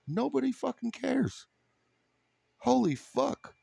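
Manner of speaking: high mock epiphany. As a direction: play the newly enlightened fool, squeaky and giddy.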